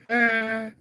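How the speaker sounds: sharp high chuckle; high laugh. Real, not acted. Laughs at rude reply.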